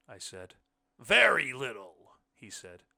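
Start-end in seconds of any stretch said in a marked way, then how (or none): 1.0-2.2 slow, loud and booming; said loudly. A narrator's reading. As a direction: turn it down loudly and slowly. Blind man's voice.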